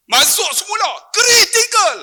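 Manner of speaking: high, loud climax of the tale; dramatic exclamation. Real, not acted. Preacher telling how mother returned to ICU, high loud exclamation. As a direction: punch it out high, almost shouting.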